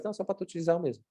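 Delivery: soft, underselling delivery; unexcited intonation. Shown as a demonstration. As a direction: say it softly as if it hardly matters. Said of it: "Ó, ó, ó a entonação da minha voz".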